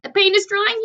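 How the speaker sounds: high, loud wailing moan. A narrator's reading. Centipede moans to the others about the drying paint. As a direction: moan in misery. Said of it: "he moaned".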